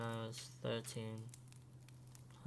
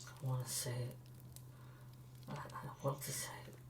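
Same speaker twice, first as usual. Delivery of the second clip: 1 hushed, held back; 7 dB quieter than usual. Acted. Holding back a terrible secret.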